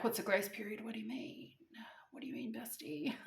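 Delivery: very soft, fading into near whisper; mock-tearful small voice. Upset caller asks agent about grace period, small and whispery. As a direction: ask it weakly, as if through tears.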